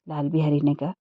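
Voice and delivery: ordinary delivery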